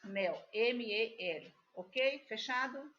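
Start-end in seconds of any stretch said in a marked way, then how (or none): 0.0-1.6 slow letter-by-letter spelling. Shown as a demonstration. Teacher spells the word mel for pupils, slow and clear. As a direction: spell M E L slowly for the class. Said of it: "que tenham a mesma iniciais".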